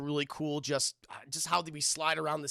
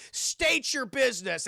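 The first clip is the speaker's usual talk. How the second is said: mock-official command, slowed and raised; gate guard's challenge; 6 semitones higher, 3 dB louder, slower than usual. Guard challenging travelers at the gate, mock-stern. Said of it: "they're like".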